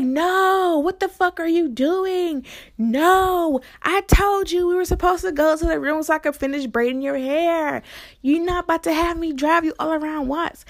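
high, exasperated scolding, stretched on the key words; angry protest. Retold or imitated. Driver refusing her passenger's detour, angry protest.

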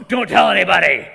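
very loud angry command; furious shout. Pretend. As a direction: bark it out indignantly. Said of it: "That's Jesus going".

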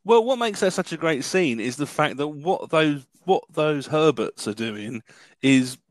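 usual speaking voice

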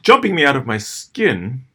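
plain reading voice

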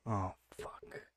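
real under-the-breath curse; soft, breathy groan of a curse; whispered curse. Real, not acted. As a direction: whisper it in dismay. Hearing the line moved.

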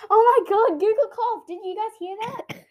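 high, loud astonished outburst; amazed excitement. Real, not acted. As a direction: exclaim in amazement to your listeners. Astonished that Google coughed.

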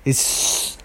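ordinary delivery

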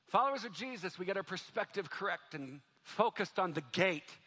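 normal talking voice

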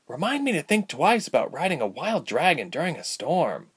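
put-on high, lilting girlish voice; rueful young Ilse. A narrator's reading. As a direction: joke ruefully as a young patient.

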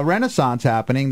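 ordinary delivery

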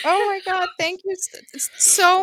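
high, tearful, stammered thanks; crying. Real, not acted. Host overwhelmed by birthday wishes from friends, crying. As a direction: choke up and stumble over the thanks.

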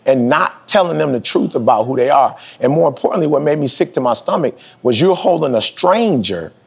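normal talking voice

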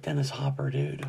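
low hushed whisper. Real, not acted. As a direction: whisper it in awe. Praising actor in hushed tone.

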